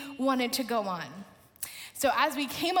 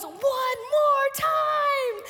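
high, loud chant-like call; excited kid shouting; 11 semitones higher, 4 dB louder, much slower than usual. Retold or imitated. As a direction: imitate a kid cheering for another round. Kid on ride calls out.

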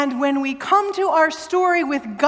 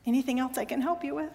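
mock polite agent; 11 dB quieter, faster than usual. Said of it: "the lady's like".

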